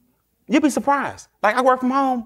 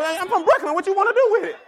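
higher, swaggering mock tough talk; 10 semitones higher, faster than usual. Man claiming Brooklyn to look bigger, higher swagger. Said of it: "You start claiming where you from".

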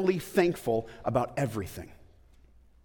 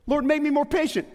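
mock plea; 11 semitones higher, 3 dB louder than usual. Shown as a demonstration. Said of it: "praying for patience".

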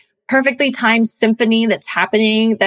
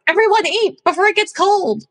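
high, raised call to the table; yelling across the room; 10 semitones higher than usual. Retold or imitated. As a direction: call everyone to eat, pitched way up. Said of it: "and you're like".

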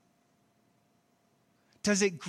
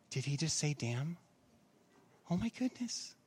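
softer, mock-shocked gasp at a swear word; mock scandalized churchgoer; 5 semitones lower, 10 dB quieter than usual. Churchgoer mock-shocked at the pastor saying damn. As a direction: play a scandalized churchgoer, lightly.

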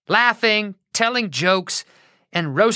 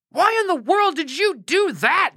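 mock shout; 11 semitones higher than usual. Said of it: "Jehu shouted".

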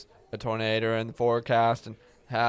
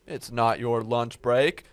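ordinary talk, nothing marked

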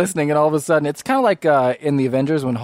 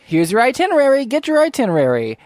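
chirpy attendant; 7 semitones higher, 3 dB louder than usual. Acted. GM voices chirpy attendant NPC.

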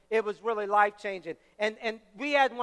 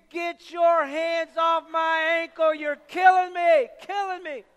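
high, loud, drawn-out mock scream; screamed pain; 5 semitones higher, 6 dB louder, slower than usual. Injured friend to the men shaking his ankle, screaming in pain. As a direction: scream it slowly, pleading to be let go. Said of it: "And he screamed".

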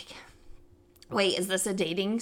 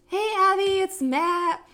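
lightly voiced suitor, pitched up; chirpy text message; 8 semitones higher, 3 dB louder, slower than usual. Imitating the blind date's text, chirpy. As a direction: read a text out in a perky high voice.

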